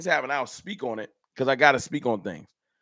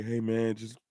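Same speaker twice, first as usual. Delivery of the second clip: soft, lower, deflated pleading; a softer, droopy mock-sad plea; 4 semitones lower, 5 dB quieter than usual. Shown as a demonstration. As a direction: mope it out like a beaten fan, softly. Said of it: "I don't want all those things in a sad way".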